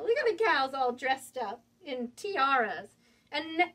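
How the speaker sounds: ordinary delivery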